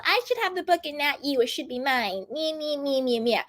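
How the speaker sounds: lightly mocked whiny man-child. Ex-boyfriend mocked as a whiny child wanting the book. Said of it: "whiny and like a child".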